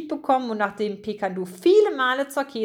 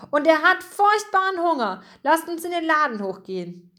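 high-pitched, eager call; rally the others with raised pitch; 6 semitones higher than usual. A narrator's reading. Mouse urges friends up to the shop, calling out. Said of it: "rief Pikandu".

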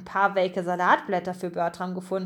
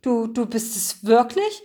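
halting, stammered disbelief; stammering. A narrator's reading. Mouse meets his missing friend in the dark, stammering. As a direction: stammer the first words, barely believing it. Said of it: "stotterte er".

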